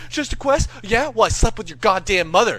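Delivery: high, loud, incredulous comeback; angry retort. Retold or imitated. Jimmy throws the excuse back, loud angry taunt. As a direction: act out a friend firing back.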